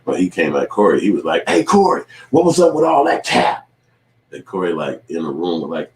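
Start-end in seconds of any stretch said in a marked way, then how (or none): 1.5-3.7 loud, high-pitched challenge; confrontational call-out. Retold or imitated. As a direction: call him out across the room, imitating the challenger. Retelling someone confronting Corey.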